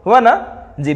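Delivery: normal talking voice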